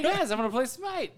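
high-pitched, eager announcement to friends; acted excitement. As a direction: announce it like an overexcited gamer. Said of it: "I was all excited".

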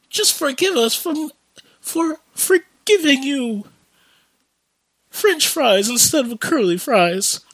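hammed-up pleading cashier; mock groveling. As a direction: beg like a terrified cashier on his knees. Mock groveling to angry customer.

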